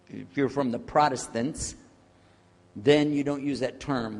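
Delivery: usual speaking voice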